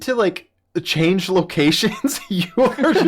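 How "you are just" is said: laughing. Real, not acted. Laughing at shivering friend.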